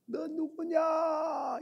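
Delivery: high, drawn-out echoing call; long, thinner repeat of a shout. Retold or imitated. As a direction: answer as the mountain's echo, not at full volume. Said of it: "이렇게 또 들려오는 거예요".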